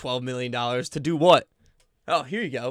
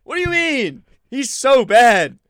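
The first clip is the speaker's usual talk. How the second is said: much higher, much louder, slow exasperated cry; incredulous rant; 9 semitones higher, 11 dB louder, slower than usual. Real, not acted. Fan answers a Twitter take, incredulous and loud. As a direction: shout down a bad take online. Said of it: "Weekly Giants rant".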